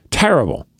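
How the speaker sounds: ordinary talk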